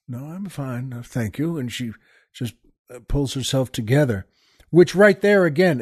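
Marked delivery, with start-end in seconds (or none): 0.1-1.6 composed quiet reply. Retold or imitated. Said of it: "and she says".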